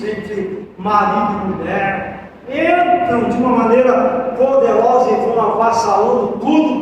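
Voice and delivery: ordinary talk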